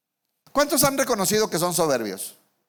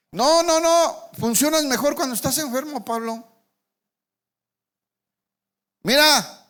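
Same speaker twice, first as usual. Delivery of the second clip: God teasing Pablo; 4 semitones higher than usual. Pretend. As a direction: playfully scold Pablo from above, pitched high. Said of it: "le está diciendo".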